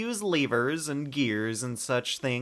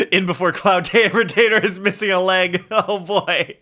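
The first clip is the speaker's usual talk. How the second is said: laughing through the words, louder; 5 semitones higher, 8 dB louder than usual. Real, not acted. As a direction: giggle through the joke about the missing leg. Laughing at the drumstick joke.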